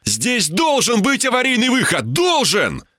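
high, insistent yell without much volume; desperate yell. A narrator's reading. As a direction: yell it, pitch jumping up in desperation. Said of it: "заорал я". (отчаянный крик, голос вверх)

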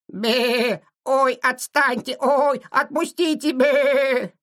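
high, quavering bleating ram; rough rattling voice. A narrator's reading. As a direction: bleat pleadingly to be let go, drawn out. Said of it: "грубый дребезжащий голос". (дребезжащий голос блеющего барана)

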